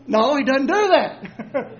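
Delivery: normal talking voice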